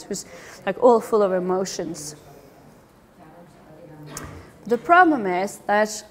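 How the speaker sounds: normal talking voice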